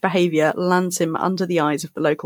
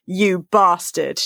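drawn-out, deadpan delivery; slow punchline; much slower than usual. Acted. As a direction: savour each word slowly. Punchline of the read quote.

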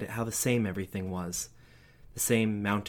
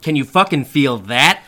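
loud, vicious snarl; furious father; 5 semitones higher, 15 dB louder than usual. A narrator's reading. Father lashing out at his scared child. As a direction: threaten with real menace. Dad hits/threatens child.

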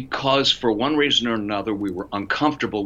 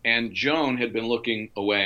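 ordinary delivery

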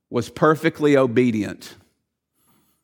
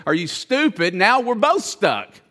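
pitched way up, incredulous and exasperated; like a man who can't believe his friend's foolishness; exasperated disbelief; 6 semitones higher than usual. Retold or imitated. Stuck man scolding his friend who jumped in, exasperated.

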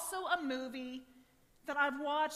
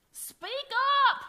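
put-on shrill high yell of an old woman; old woman shouting; 12 semitones higher, 11 dB louder than usual. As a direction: bellow it like a deaf old lady. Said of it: "an elderly woman shouts to Jesus".

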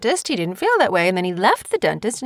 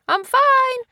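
high-pitched mock holler; imitated yell. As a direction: mimic a kid calling from another room. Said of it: "he just yelled from the other room".

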